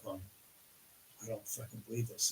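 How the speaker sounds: near-silent awed mutter under the breath; hushed, stunned fan disbelief; hushed starstruck disbelief. Retold or imitated. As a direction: gasp it softly as if to yourself. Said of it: "and I'm like".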